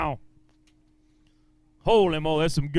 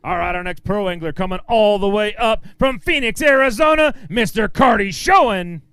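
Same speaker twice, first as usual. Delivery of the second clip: announcer intro; 3 semitones higher, 4 dB louder than usual. Acted. Emcee introducing the next pro to the crowd, booming announcer style. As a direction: sell the name like a boxing intro.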